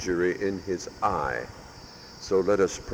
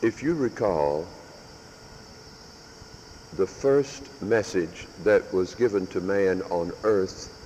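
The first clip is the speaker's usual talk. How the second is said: usual speaking voice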